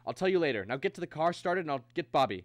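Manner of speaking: loud, pressing instructions; urgent orders. Acted. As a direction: brush off questions and rush them. Hurries wife to flee.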